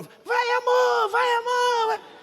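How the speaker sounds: very high, slow squeaky mock cheer; wife cheering him on, mimicked very high and drawn out; mimicking his wife cheering. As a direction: ham up the wife's shrill little cheer from poolside. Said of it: "Ela ficou".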